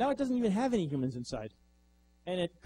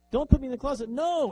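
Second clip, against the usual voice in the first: louder, higher desperate begging; panicked plea; 8 semitones higher, 6 dB louder than usual. Retold or imitated. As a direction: beg harder in panic. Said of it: "And the robot says".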